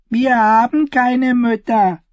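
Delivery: put-on high, slow little girl's voice; a little orphan girl answering strangers, high and slow; small girl. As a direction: say it plainly like a small child.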